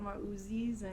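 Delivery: stretched Minnesota oohs; Minnesota accent. Shown as a demonstration. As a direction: lean into the Minnesota vowels. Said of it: "the oohs come out a little more".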